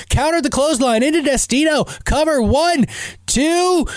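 high, excited ringside commentary rising to the count; wrestling play-by-play. Acted. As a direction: call the match like it's live at ringside. Live wrestling play-by-play call.

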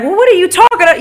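high-pitched retold challenge to a co-worker. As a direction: re-enact raising your voice in an argument. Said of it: "I raised my voice like".

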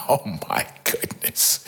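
breathless, tearful whisper, deeply moved; overwhelmed, barely voiced; shaken whisper. Real, not acted. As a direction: let awe choke the voice to a whisper. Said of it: "I was a little shaken myself".

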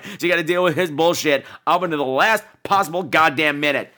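normal talking voice

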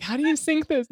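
a mock-baffled whine; confused question. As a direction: sound stumped by the app. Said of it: "I'm like".

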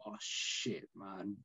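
breathless whispered oh shit to himself; a quick private whisper of alarm; whispered panic. Retold or imitated. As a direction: hiss it under the breath like a sudden realisation. Panicked inner thought after promising.

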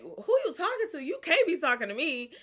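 high, loud, disbelieving; can't believe he's picked; incredulous Gideon. Retold or imitated. Gideon told he's mighty, answers in loud high disbelief. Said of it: "Gideon looking like".

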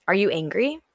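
ordinary delivery

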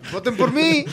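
high, loud mock candidate pitch; campaign slogan voice. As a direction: sell the vote like a cheesy candidate. Campaign slogan in Meade parody. (voz de candidato en campaña)

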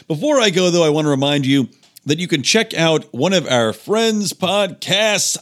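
ordinary talk

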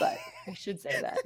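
breathy laugh through the words. Real, not acted. Laughing at her own forgetfulness, through laughter. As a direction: say it while laughing.

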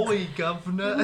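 hammed-up Cockney British accent; play-acting a British cabbie, mock British accent. Joking about a British author mom, playful British accent. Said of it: "my British accent".

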